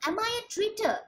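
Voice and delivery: high-pitched indignant question, not really asking; emphatic rhetorical question. Shown as a demonstration. Teacher demonstrating a rhetorical question with mock indignation. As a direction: fling the question out as a protest of innocence. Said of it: "I'm trying to emphasize the fact".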